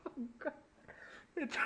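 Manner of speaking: real giggle while reading; cracking up mid-sentence; breaking up laughing. Real, not acted. Reader losing it over the silly story, laughing. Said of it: "I can't do this".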